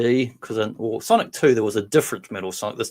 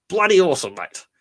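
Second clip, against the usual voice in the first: higher, louder, punched catchphrase; hammed-up Aussie enthusiasm; 8 semitones higher, 5 dB louder than usual. Fan shouting out to a cosplayer, hammed-up cheer. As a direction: sell it like a cheery Aussie bloke.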